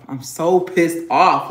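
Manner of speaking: angry. Real, not acted. Repeats it louder and slower.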